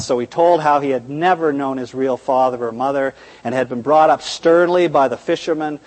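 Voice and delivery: ordinary talk, nothing marked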